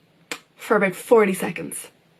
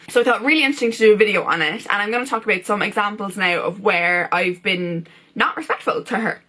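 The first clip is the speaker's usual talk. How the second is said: ordinary talk, nothing marked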